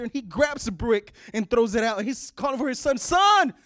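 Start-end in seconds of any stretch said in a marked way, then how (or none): 3.0-3.5 loud, high call for his son; a father yelling for his lost son. Acted. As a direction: shout his son's name.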